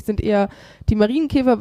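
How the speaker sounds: ordinary talk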